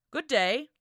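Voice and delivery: loud, high friendly greeting called out. Acted. Lachlan greets the approaching captor, loud friendly call. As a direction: call out with forced good humour. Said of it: "Lachlan called".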